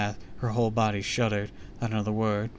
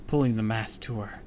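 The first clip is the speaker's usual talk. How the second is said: ordinary delivery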